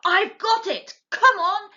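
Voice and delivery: high, loud sudden idea; excited shout. A narrator's reading. Mr Fox rousing his family to dig, shouting. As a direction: burst out with the idea. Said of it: "He jumped up and shouted".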